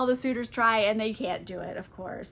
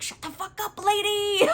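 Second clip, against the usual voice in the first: high-pitched mock-frantic hushing; urgent shushing; 10 semitones higher than usual. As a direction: imitate someone desperately silencing a blabbermouth. Odysseus frantically silencing the nurse.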